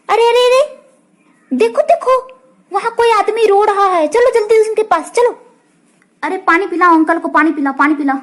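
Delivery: put-on high, urgent child voices; alarmed kids calling out; excited children. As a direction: rush over to help the man. Children spot crying man.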